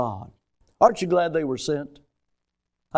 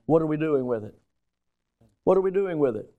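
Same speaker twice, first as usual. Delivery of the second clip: ordinary talk, nothing marked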